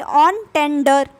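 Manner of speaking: "on tender" said very slowly, on like English on, then tender; careful pronunciation. Shown as a demonstration. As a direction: spell out "on tender" slowly, syllable by syllable. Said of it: "on है ये".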